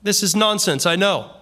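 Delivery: normal talking voice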